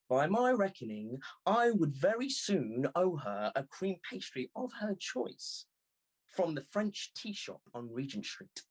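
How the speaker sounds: usual speaking voice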